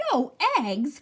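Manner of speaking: loud, shocked question; cried out. Acted. Farmer crying out over the hens' strike, loud. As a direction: blurt it out in dismay. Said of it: "cried Farmer Brown".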